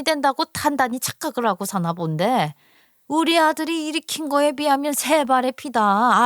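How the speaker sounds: irritated, belittling in-law tirade; scornful mother-in-law. Acted. Mother-in-law belittling daughter-in-law's earnings beside her son's, scornfully. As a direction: put her in her place, indignantly. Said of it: "시어머니가 성질을 부리면서 말을 하더라고요".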